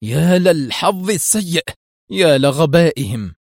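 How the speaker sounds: slow, drawn-out angry exclamation; acted rage at rotten luck; furious lament. A narrator's reading. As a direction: wail in exasperation, stretching the words. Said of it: "وهو يصيح بغضب شديد".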